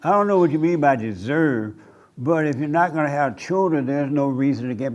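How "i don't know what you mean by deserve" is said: ordinary talk, nothing marked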